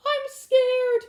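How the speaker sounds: very high loud squeak. Acted. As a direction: squeak it like a frightened little rabbit. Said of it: "squeaked Pipkin".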